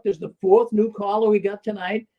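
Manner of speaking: ordinary delivery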